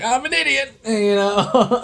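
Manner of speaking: very high, sheepish put-on voice; imitating Dylan O'Brien. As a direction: squeak it out like a guy embarrassed at himself. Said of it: "so Dylan O'Brien in this scene".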